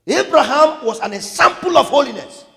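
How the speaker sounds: high, loud proclamation; ringing pulpit cry; full-force preaching. Real, not acted. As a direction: declare it forcefully to the congregation.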